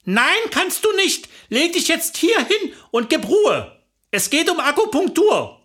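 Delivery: high-pitched, firm orders; exasperated wife giving orders; bossy scolding. Acted. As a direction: lay down the law, pitched high. Wife scolds reluctant husband.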